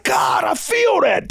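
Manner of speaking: slow, drawn-out mock cry of awe; awed moviegoer. Viewer imagining the film done right, slow half-mock awe. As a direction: stretch it out like you're deeply moved. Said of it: "Your soul would have been like".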